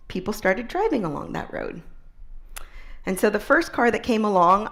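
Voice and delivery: ordinary talk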